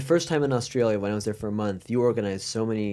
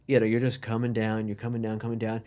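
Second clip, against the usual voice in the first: ordinary talk, nothing marked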